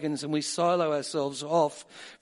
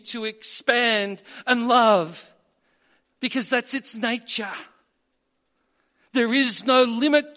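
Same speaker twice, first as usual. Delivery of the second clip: much higher and louder, fervent preaching; fervent preaching climax, higher and louder; full-force preaching; 7 semitones higher, 5 dB louder than usual. Acted.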